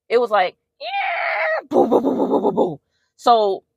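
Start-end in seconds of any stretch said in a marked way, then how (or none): 0.8-1.7 a sudden shriek-like yell, like a beast; imitated animal shriek. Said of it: "like a wild animal shrieking". 1.7-2.8 rapid booms, voiceless and higher; imitated booms. Imitating thumping noises.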